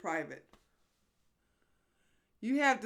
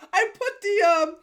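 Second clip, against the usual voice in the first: usual speaking voice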